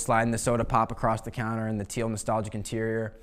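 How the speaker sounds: ordinary talk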